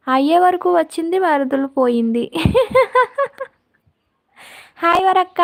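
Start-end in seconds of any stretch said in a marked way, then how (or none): 2.4-3.4 high, rippling laugh; amused laugh. Real, not acted. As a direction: break into a high-pitched run of laughter. Amused after her teasing remark. (గట్టిగా పకపకా నవ్వు)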